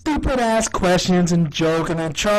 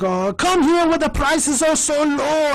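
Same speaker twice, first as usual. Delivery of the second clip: high-pitched mock Irish accent; Crazy Kelly; 8 semitones higher than usual. D pitches as her wacky character, high Irish accent. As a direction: sing out a sales line in a hammy Irish accent. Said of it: "has this Irish accent".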